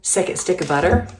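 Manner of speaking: ordinary talk, nothing marked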